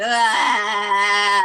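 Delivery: a loud, open ah from the throat; sounding it out. Shown as a demonstration. Showing listeners how to sound out tension, a loud open ah. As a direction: let one full ah ring out. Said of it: "just sound it out".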